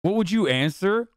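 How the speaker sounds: ordinary talk, nothing marked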